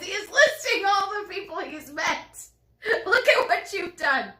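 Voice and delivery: high, loud, laughing through the words; laughing at the co-host listing people; laughing teasing. Real, not acted. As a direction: tease him while cracking up.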